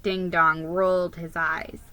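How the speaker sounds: ordinary delivery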